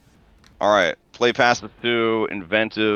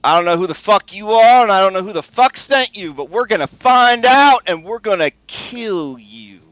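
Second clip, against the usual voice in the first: raised, high, loud threatening voice; shouted threat; 8 semitones higher, 6 dB louder than usual. Acted. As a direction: shout the threat from round a corner, building to a peak. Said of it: "she just shouts from the".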